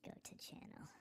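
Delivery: unvoiced whisper. Real, not acted. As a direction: whisper it under your breath. Whispered aside.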